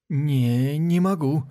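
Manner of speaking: high whimpering refusal; whining. Acted. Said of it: "прохныкал Касмаля".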